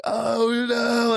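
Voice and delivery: high, hammed-up cry of alarm; mock panic. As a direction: wail it like fake despair. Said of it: "And I'm like".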